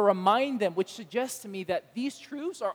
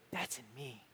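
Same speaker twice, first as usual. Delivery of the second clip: hushed in me; 9 semitones lower, 15 dB quieter than usual. Retold or imitated. Quiet disbelieving echo of claim.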